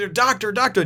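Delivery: high-pitched frantic call for help; mock panicked screaming. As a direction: imitate someone calling out in alarm. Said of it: "like, screaming around".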